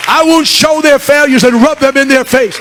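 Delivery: high, loud pulpit cry building in force; impassioned preaching. Retold or imitated. God's message to his returning people, full-force preaching. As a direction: preach it out, stretching the phrase ends.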